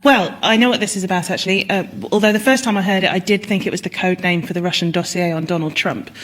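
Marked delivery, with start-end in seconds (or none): none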